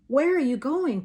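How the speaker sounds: high, loud call after wagon; boy yells. A narrator's reading. Little brother yells after wagon, loud. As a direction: shout after his sister. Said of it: "Tomas yells".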